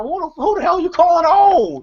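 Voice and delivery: high, mock-outraged retort; offended old lady. Old lady answering being called old, high offended squawk. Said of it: "that offends the old ladies".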